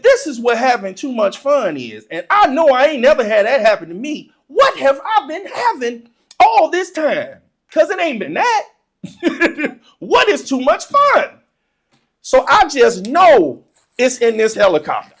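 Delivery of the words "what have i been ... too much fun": high-pitched, bewildered questioning; incredulous kid. Retold or imitated. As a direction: demand it like a baffled child. Child incredulous about fun explanation.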